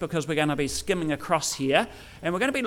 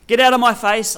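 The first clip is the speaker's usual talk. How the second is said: higher, loud outburst; angry rejection; 4 semitones higher, 7 dB louder than usual. Shown as a demonstration. Preacher acting someone who has had enough, angry rejection. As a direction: drive them away with raised voice. Acted angry dismissal as example.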